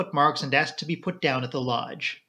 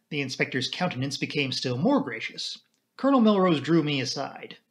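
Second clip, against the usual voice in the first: plain reading voice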